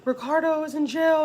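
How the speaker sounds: high-pitched mock wail; crying voice without real sobs; retold crying. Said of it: "she starts instantly crying".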